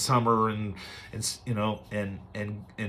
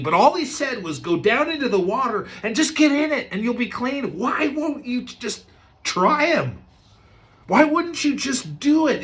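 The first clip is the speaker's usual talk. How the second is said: much higher, urgent pleading; pleading servant; 10 semitones higher than usual. Retold or imitated. Servant urging proud Naaman, high and pleading. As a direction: beg him to just try it.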